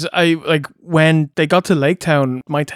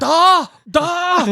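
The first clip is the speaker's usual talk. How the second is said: high, ringing imitation of the boy's Da; mock Welsh boy; 13 semitones higher than usual. Mocking the boy actor's odd Welsh accent, high Da. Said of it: "What's that all about?".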